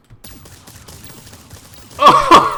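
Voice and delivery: high squealing burst of laughter, very loud; delighted shriek of laughter. Real, not acted. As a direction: let out a shrieking laugh of pure joy. Joyful reaction to mod.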